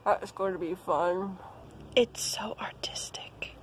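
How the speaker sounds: soft breathy whisper aside. Real, not acted. Vlogger whispers about glam poses, a whisper. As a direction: hush it like a secret.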